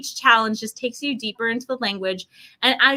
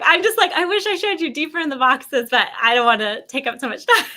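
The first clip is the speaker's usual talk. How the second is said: laughing through the words, higher; sheepish laughing aside; 4 semitones higher than usual. Retold or imitated. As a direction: giggle while confessing it. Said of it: "I was like".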